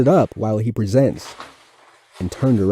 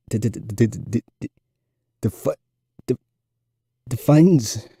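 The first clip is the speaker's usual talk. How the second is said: heavy stutter. A narrator's reading. Nervous fourth grader stuttering through his book report to class. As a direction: fight through each repeated sound. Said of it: "relieved that his presentation was over".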